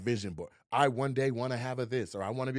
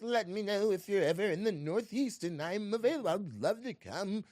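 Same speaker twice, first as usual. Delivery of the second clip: higher, mannered imitation of an actor; John Malkovich impression; 7 semitones higher than usual. Actor inviting a co-star to a show, imitated. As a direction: put on the actor's peculiar way of talking. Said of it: "that's how he talks for real".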